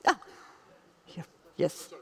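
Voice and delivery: ordinary delivery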